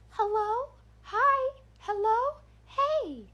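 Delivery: put-on very high, eerie little-girl calls with rising ends; sing-song questioning like a horror-movie doll; creepy doll voice. Voice circling the fence line, creepy doll calling. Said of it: "that creepy doll voice".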